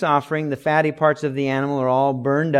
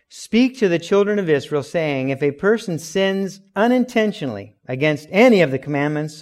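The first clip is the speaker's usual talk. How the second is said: raised, louder proclaiming of scripture; scripture reading; 2 semitones higher, 5 dB louder than usual. Acted. Preacher reading Leviticus aloud to the church, proclaiming. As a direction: read the verse out to the congregation with weight.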